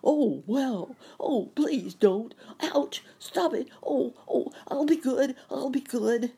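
high, loud yelps of pain; crying out. A narrator's reading. Pessimist pinched hard on his tail, loud pleading cries. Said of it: "that the pessimist cried".

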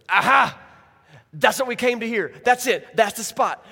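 hammed-up accusers' "aha" moment; triumphant gotcha. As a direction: burst out as if you've caught him red-handed. Acts out leaders pouncing on Jesus.

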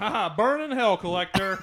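loud, high gloating taunt; gloating cheer. Pretend. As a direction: crow over the loser, laughing. Gloating over the losing movie.

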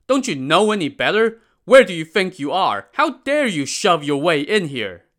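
loud, high indignant scolding; angry guards. Acted. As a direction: tell them off at full voice. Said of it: "the guards shouted at them".

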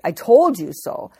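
a hammed-up told-you-so scold; mock scolding. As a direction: rub it in like a scolding parent. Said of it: "He didn't give her a big scolding".

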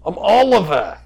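loud, high self-announcement; proud character introduction. Acted. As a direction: announce yourself grandly in character. Oliver announces himself in character.

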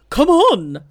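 high, very loud hooting call. A narrator's reading. Owl passes the call down the line, hooting loudly. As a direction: whoop it out, stretching the last word. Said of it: "called Owl".

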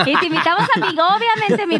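fast, laughing through it; giggling invitation. Real, not acted. Laughing joke invitation to Pinky Promise.

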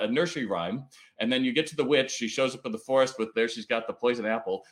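ordinary talk, nothing marked

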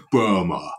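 Burma said with marbles in the mouth, no R; mumbled Burma. Shown as a demonstration. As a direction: swallow the R in Burma. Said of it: "say that with more marbles in my mouth".

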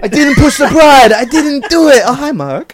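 high melodramatic denial easing into casual greeting; Tommy Wiseau. Acted. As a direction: overact the protest, then drop into nonchalance. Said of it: "is it the Wiseau wedding?".

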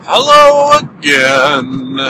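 ordinary delivery

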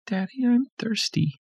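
put-on very high tired child; little girl voice. A narrator's reading. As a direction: weakly ask her father.

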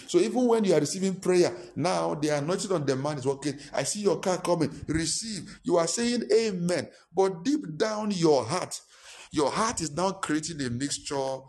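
high, loud preaching build; fired-up pulpit preaching; full-force preaching. Real, not acted. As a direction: preach it out, ringing.

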